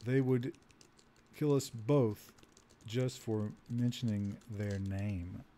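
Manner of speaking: slow, broken phrases trailing down softly; slow dictation. Real, not acted. Player typing an answer to the captain, slow dictation. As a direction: say each word as you type it. Speaking slowly while typing input.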